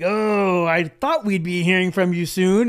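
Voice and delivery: quoting Raver loudly, as if he expected the call; a big knowing "oh" thrown out loud; loud mock greeting. Retelling Raver's loud knowing welcome to the host. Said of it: "Raver was like".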